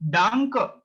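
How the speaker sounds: Danke ending in an open "a" sound; final E as "a". Shown as a demonstration. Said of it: "it's sound like a".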